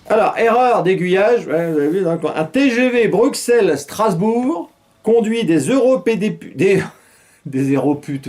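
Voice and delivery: news read. Acted. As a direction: announce the headline like a TV anchor. Reads news item.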